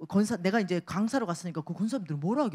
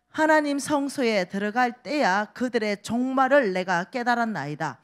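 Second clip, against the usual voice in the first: louder solemn Bible reading; scripture reading; 3 semitones higher, 6 dB louder than usual. Acted. Reads scripture aloud. (힘있게 성경 봉독)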